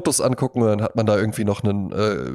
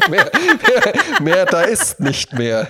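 laughing; 8 semitones higher, 4 dB louder, much slower than usual. Acted. Joking self-mockery, laughing.

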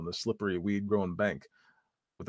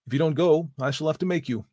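stern threat; 7 semitones higher, 7 dB louder than usual. Acted. Landowner threatens the wild boy.

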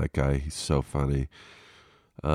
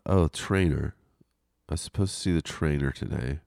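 normal talking voice

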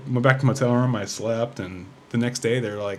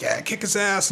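half-voiced crowd yell, pitched up; retold crowd cheer; 8 semitones higher, slower than usual. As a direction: imitate a fight fan egging him on. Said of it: "people are yelling".

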